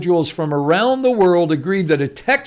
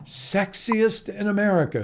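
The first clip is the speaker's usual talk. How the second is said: softer, musing repeat of the headline; hushed echo; 5 semitones higher, 6 dB quieter than usual. Pretend. Host echoes a surprising headline about Texas accents, hushed. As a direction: say it softly, as if savoring it.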